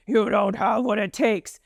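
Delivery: put-on goofy character voice; silly mock voice. Shown as a demonstration. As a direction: say it in an absurd, altered voice. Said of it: "of silly voices".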